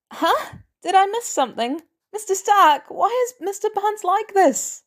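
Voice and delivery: put-on high, puzzled teen voice; confused teen Peter. Peter asking Mister Stark why the team laughs, high and confused. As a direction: ask it high and lost, like a bewildered kid.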